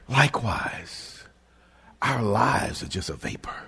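breathy whispered aside; hushed sermon whisper. Real, not acted. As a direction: drop to a whisper for weight.

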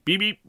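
high beep beep horn; car horn beep. Acted.

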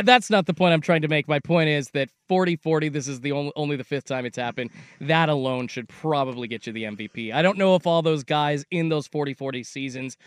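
usual speaking voice

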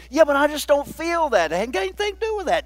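high-pitched quick imitating; mock doubter, then quick retort; mock objection. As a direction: toss off the doubter's excuse lightly. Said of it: "voicing a doubter, then retort".

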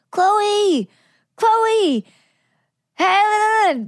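high, drawn-out mock yell; imitated calling out. Imitating friend calling from five levels up, high mock holler. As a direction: call like a friend from far above. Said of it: "I can hear her going".

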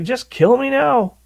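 high, hammed-up plea to die; mock despairing Jonah. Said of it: "Jonah's like".

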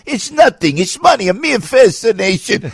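high, slow, grand mobster boast; a mobster waving off a pile of cash, high and slow; mobster quote. Retold or imitated. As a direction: toss the money off like it means nothing. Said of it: "They go".

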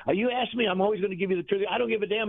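high-pitched, insistent self-defence; retold defiance. Coach answers manager's accusation, retold high-pitched defiance. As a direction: retell standing your ground, pitched well up.

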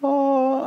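high drawn-out "oh"; mock high-pitched "oh"; imitated head-spin moment. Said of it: "just kind of like this".

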